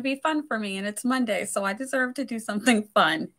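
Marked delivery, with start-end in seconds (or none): none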